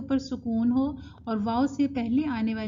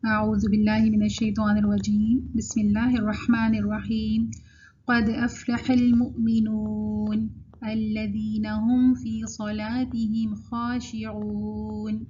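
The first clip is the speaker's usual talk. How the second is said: louder, measured tajweed chant on a level pitch; Quran recitation; 3 dB louder, slower than usual. Real, not acted. Teacher reciting the verse of the day, slow chant. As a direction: recite with long held vowels.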